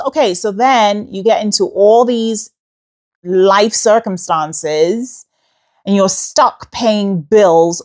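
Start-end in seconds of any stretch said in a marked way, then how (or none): none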